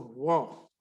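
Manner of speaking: 'व' as a bare short 'va', no R; shortened 'were'. Shown as a demonstration. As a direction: demonstrate 'व' clipped of its R. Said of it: "छोटा करके बताऊंगा". (छोटा 'व' उच्चारण)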